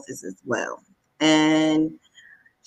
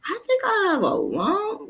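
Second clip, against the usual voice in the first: higher, tentative admission; hesitant confession. Retold or imitated. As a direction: confide it carefully. Retold telling mom figure.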